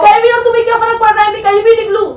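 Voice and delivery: high, fast, indignant outburst. Real, not acted. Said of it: "तो धीरे बोलो ना".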